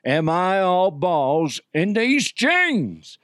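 hammed-up cowboy, drawn out to the last word; Cowboy DeHuff. Cowboy delivers his running punchline, in a slow put-on cowboy voice. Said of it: "the ongoing theme of Cowboy DeHuff was".

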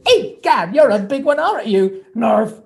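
high, loud goofy cartoon mouse voice; Pinky cartoon voice. Voice actor greets a fan as Pinky, high and goofy. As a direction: ham up the silly sidekick voice.

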